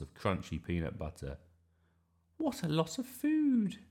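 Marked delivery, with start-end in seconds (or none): 2.4-3.8 put-on high-pitched child's voice; little Iris. Iris seeing Grandma's huge shop, high childlike wonder. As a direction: pipe up like an amazed little girl. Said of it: "said Iris".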